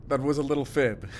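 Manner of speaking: sheepish, amused admission; laughing confession. Acted. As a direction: laugh while owning up. Confessing a small lie.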